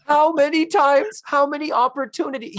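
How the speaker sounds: high-pitched rhetorical disbelief; exasperated. Real, not acted. Despairing at film girls' missed chances, exasperated. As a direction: throw up the questions in high frustration.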